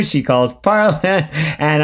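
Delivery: usual speaking voice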